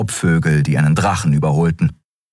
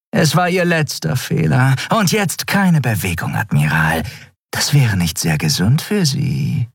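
higher, mocking and cool; silky threat; 8 semitones higher than usual. A narrator's reading. Traitor warning the admiral not to move, silky and amused. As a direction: threaten him with mock politeness.

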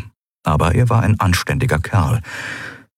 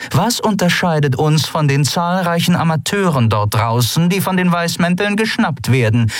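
stern captain lecturing; 9 semitones higher than usual. A narrator's reading. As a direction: drill the point home like a stern captain. Zaylar lectures her subordinate.

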